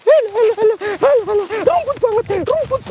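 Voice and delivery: high, loud startled shrieking; panicked cries. Real, not acted. Startled cries during live ghost hunt. (jeritan panik bernada tinggi)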